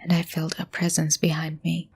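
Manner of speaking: hushed, measured horror narration; suspenseful story narration. Acted. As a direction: tell it like a creepy campfire tale.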